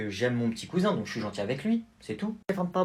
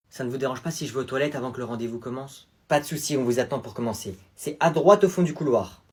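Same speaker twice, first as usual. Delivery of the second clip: ordinary delivery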